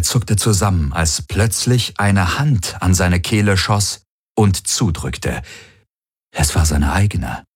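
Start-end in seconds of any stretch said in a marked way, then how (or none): none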